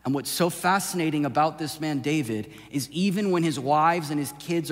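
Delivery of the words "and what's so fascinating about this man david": ordinary delivery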